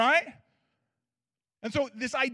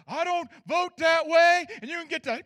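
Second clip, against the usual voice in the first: retold angry neighbor; 6 semitones higher, 6 dB louder than usual. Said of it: "and he all of a sudden".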